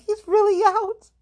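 high, loud burst of retold excitement; excited. Telling fans her friend is out, high and excited. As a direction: squeal it out like the news just dropped. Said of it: "and I was so happy".

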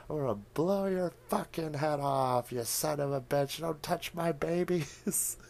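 high, slowing at the end, protective; mock scream. Old woman screaming at the creeper in her house, high and mock. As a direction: yell it to guard the cats. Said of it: "she's screaming".

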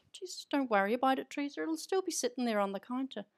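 a light Irish lilt, fast and dismissive; breezy Irish accent. Retold or imitated. Auntie reassuring Mum about the bag, in a quick, light Irish accent. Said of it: "you went into an Irish accent there".

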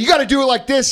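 high-pitched, emphatic demonstration. Retold or imitated. Mentor Bear shows the beginner how, excited. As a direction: show him how with urgent energy. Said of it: "raising his voice and getting excited".

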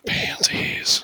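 sultry breathy whisper. Shown as a demonstration. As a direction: whisper it seductively. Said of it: "like, whispering".

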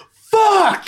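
imitated outburst, high and loud; sudden shout. Friend imitating a man cursing in a grocery store, sudden shout. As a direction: yell it out like a random curse. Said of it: "you just hear him go".

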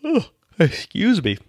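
yawning through words; mid-yawn apology. Real, not acted. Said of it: "I'm yawning and sneezing".

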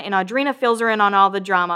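ordinary talk

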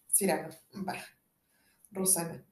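ordinary talk, nothing marked

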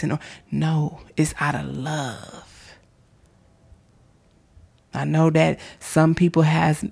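ordinary delivery

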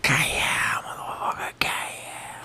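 whispered put-on gangster line; imitating Tony in a whisper; Tony Montana whisper. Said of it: "Tony goes".

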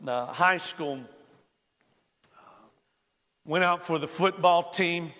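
usual speaking voice

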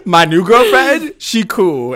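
mock Archie. Mocking Archie's new girlfriend line, hammed-up dude voice. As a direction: ham up a dopey boyfriend.

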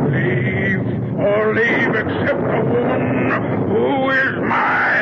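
high, slow, archaic threats from a man gone mad; possessed ranting madman. Acted. Possessed son threatens his family, in high slow ranting. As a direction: declaim it like a possessed prophet.

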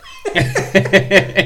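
loud, high-pitched laughing fit; big laugh. Real, not acted. Cracking up at his own story.

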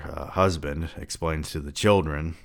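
ordinary delivery

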